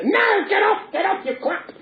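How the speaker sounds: high, squawked puppet shout; indignant Punch. Acted. Punch rejects the minister's revelation, high mock-angry squawk. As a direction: shoo them away in mock fury.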